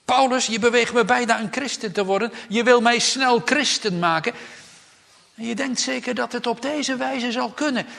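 loud, high mocking retort. King Agrippa scoffing at the apostle Paul, loud and mocking. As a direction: sneer it at Paul, scoffing loudly. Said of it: "Hij zegt het spottend".

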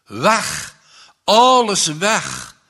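luid, hoog en traag uitgeroepen; wanhopig. A narrator's reading. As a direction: jammer het uit. (wanhopige uitroep)

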